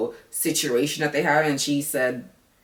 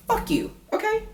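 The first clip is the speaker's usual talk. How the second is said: high squealed fuck you; mock squeal. Real, not acted. Mocking squeal at Clay.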